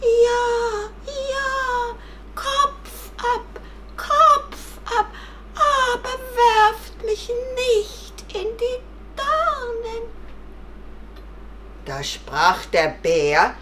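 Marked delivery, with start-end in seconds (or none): 0.0-10.1 very high, drawn-out tearful wail; pleading. Acted. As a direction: beg slowly in a tiny rabbit voice. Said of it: "Und das Kaninchen flehte". (flehend und jammernd)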